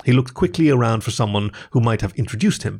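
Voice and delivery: ordinary delivery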